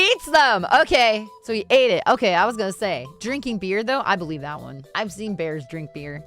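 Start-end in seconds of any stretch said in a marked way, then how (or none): none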